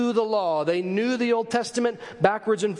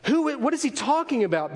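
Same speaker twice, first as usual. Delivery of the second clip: acted scandalized disbelief; mock-appalled questioning; shocked, upset; 3 semitones higher than usual. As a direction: play a shocked onlooker after a gasp. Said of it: "They are upset at what he says".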